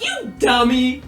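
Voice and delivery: slow, drawn-out fond scold; drawn-out affectionate "dummy"; slow teasing. Retold or imitated. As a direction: tease them slowly like a fan. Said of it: "I'm like".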